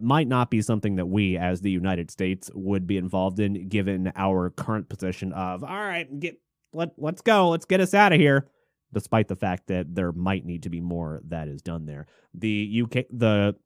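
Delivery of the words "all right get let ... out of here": mock evacuation call. Acting out a hasty evacuation call.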